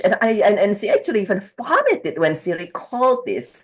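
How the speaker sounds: normal talking voice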